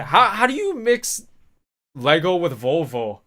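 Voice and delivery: high, slow, incredulous squeak. Real, not acted. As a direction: squeal it in disbelief. Laughing at his own mix-up.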